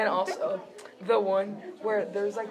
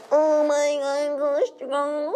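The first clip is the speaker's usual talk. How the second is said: high, loud, drawn-out mock exclamation; meme girl imitation; 7 semitones higher, 6 dB louder than usual. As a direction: stretch the quote out, high and dramatic. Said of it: "and she said".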